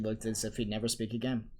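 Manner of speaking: plain reading voice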